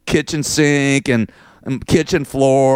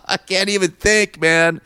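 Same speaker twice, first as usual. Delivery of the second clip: words spilling out through a laugh; 8 semitones higher than usual. Real, not acted. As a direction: laugh helplessly while talking. Laughing at own frustration.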